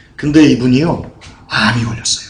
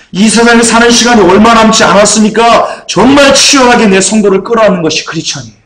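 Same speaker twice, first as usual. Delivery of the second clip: raised, emphatic sermon climax; full-force preaching; 6 semitones higher, 4 dB louder than usual. Real, not acted. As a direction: press the charge home with urgency.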